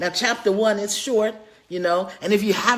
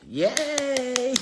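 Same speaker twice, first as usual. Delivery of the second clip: excited cheer; 8 semitones higher than usual. Real, not acted. Celebrating finished filming.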